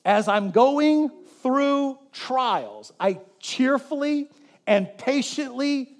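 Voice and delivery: drawn-out, building preacher cadence; slow emphatic preaching. Acted. Pastor drawing out the lesson to the congregation, slow and emphatic. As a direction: lean on every phrase like the pulpit.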